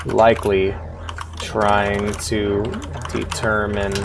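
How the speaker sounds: slow, measured reading of the game's text; slow story reading. A narrator's reading. Streamer reading the game's story aloud, slow and measured. Streamer reads game narration.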